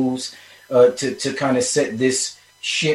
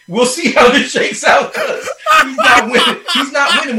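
laughing through the words; words breaking into laughter; 11 semitones higher, 4 dB louder than usual. Real, not acted. Laughing while ranting.

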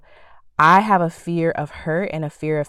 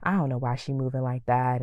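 softer and fast, as if thinking it to herself; inner voice muttered; 4 semitones lower, 7 dB quieter than usual. Retold or imitated. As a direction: hold it in and mutter quickly under your breath. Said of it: "telling yourself mentally".

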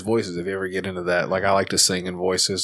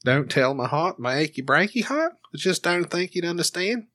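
higher-pitched Australian lyric recital; rising Australian lilt on each line; Australian accent; 6 semitones higher than usual. Shown as a demonstration. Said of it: "I just practice singing songs in Australian".

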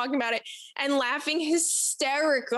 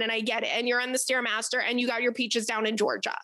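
normal talking voice

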